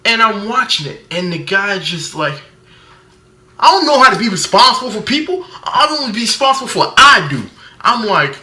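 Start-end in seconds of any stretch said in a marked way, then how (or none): 3.6-7.4 higher, louder and fast, mocking an over-hyped actor. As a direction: rattle it off like a too-hyped actor. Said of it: "[name] wasn't always this hype".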